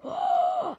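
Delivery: high, loud gasp of amazement; amazed gasp. Pretend. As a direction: gasp sharply in wonder. Amazed reaction to a catch. (ohromené hlasité zalapání po dechu)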